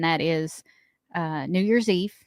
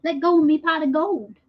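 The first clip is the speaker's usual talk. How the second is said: high mock leprechaun; bad Irish leprechaun line; 8 semitones higher than usual. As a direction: play a greedy leprechaun. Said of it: "That's not Irish".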